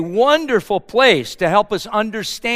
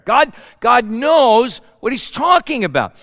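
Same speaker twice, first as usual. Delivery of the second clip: normal talking voice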